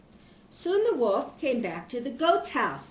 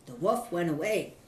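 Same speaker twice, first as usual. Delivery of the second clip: plain reading voice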